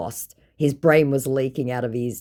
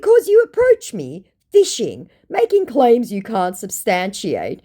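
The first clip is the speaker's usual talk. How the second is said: indignant retort; 7 semitones higher, 6 dB louder than usual. Retold or imitated. Her own retort read aloud.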